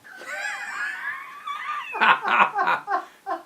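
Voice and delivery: a high, loud helpless laugh; loud burst of laughter. Real, not acted. Husband bursting out laughing while filming his wife. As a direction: let a big shrieking laugh burst out. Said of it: "I'll never forget this day".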